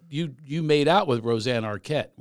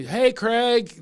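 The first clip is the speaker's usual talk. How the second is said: calling out; 9 semitones higher, 4 dB louder than usual. Retold or imitated. Daryl calling down from tree.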